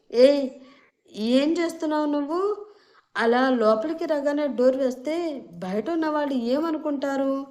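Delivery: startled and anxious, half-shouted; flustered. A narrator's reading. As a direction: blurt it out in a panic. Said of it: "కొంచెం కంగారుగా అడిగాడు మహేష్".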